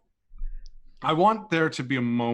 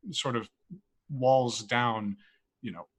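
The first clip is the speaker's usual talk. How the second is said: usual speaking voice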